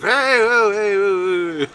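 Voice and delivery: high, loud startled calls of hey whoa; alarmed calling out. Retold or imitated. Bandmates calling out as the drunk guy collapses, high startled shouts.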